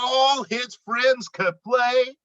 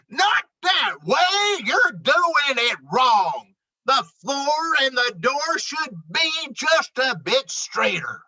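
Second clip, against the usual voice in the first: bossy shout; 5 semitones higher than usual. Acted. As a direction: snap orders at the crew like a know-it-all foreman. Moose bosses the builders.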